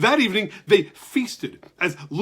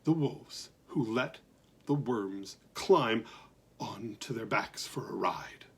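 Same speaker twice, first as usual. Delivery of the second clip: hushed; 6 semitones lower, 10 dB quieter than usual. A narrator's reading. Hushed narration.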